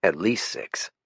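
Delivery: quiet aside, half to yourself; muttered. Acted. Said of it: "I muttered".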